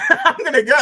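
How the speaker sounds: high, loud, laughing outburst; cracking up as you say it. Real, not acted. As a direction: say it through a burst of laughter. Joking about his rival's review.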